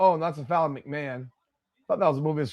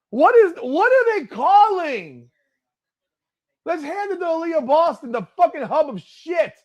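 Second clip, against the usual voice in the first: furious outburst; 11 semitones higher, 4 dB louder than usual. Real, not acted. Fan yelling at the refs' call, high furious complaint. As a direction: rail at the refs.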